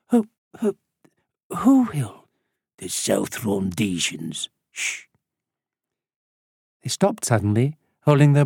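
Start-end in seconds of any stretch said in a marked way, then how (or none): 0.1-2.3 higher, halting and alarmed; nervous stammer. A narrator's reading. Traveller asking the smuggler who will shoot, nervous stammer. Frightened reply to shooting warning. 2.8-5.1 low, slow, soft answer ending in a shush; name them slowly under your breath; hushed smuggler. A narrator's reading. Old smuggler silencing the group in the woods, low and hushed.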